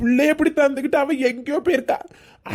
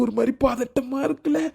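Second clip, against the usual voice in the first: usual speaking voice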